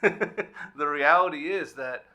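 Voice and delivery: a light chuckle that eases into slow words; laughing. Real, not acted. Panelist laughing at his own jab at economists. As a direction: laugh it off, then go on slowly. Jokes Doctor Bowling will smack him.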